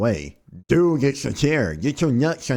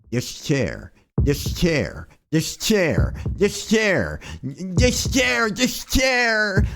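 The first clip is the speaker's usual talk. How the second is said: building chair rant; 6 semitones higher, much slower than usual. Acted. Man fixating on the chair that hurt him, escalating mock meltdown. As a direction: repeat it obsessively, pitch ratcheting up each time.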